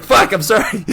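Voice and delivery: high, loud apology through laughter; laughing apology. Retold or imitated. Apologizing to the startled girl, laughing loudly. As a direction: blurt sorry while laughing. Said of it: "I was like".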